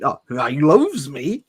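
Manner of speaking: slow, bright, sing-song cheer; cheerful. Acted. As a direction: say it gleefully, drawn out. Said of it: "he said cheerily".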